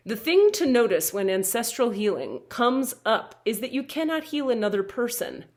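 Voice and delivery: ordinary talk, nothing marked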